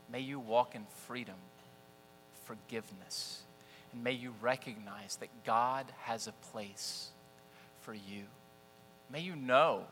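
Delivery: quiet prayerful blessing. Acted. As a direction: pray it over them gently. Closing blessing.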